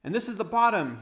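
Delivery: loud, high shout up the stairs; boy shouting up from below; shouted back. Acted. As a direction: call back from the bottom of the steps. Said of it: "he shouted back".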